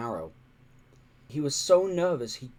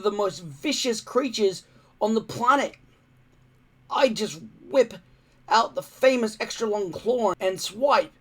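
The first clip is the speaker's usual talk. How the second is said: put-on higher, louder boy bragging; lightly voiced cocky young Viking; boastful boy; 8 semitones higher, 6 dB louder than usual. A narrator's reading. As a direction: show off like a know-it-all kid.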